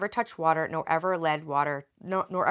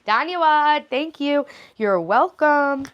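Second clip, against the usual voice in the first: high, louder cheery thanks; playful thanks; 8 semitones higher, 8 dB louder, slower than usual. Real, not acted. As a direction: beam at the chat.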